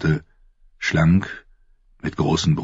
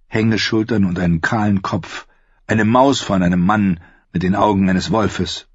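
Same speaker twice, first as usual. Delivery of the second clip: usual speaking voice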